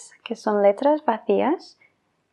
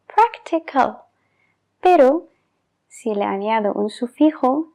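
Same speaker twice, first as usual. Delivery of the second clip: ordinary talk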